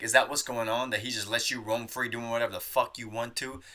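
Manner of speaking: usual speaking voice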